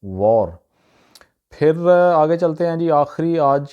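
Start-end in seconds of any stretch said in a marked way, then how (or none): 0.0-0.6 वॉर with a rounded "aw", wor; native English pronunciation. Shown as a demonstration. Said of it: "pronunciation तलफ़ुज़ है".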